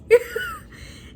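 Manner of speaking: very high laughing shriek; squealing laugh. Real, not acted. Vlogger shrieking with laughter at Luke's reaction. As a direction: burst into a squeal.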